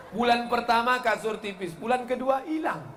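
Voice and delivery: normal talking voice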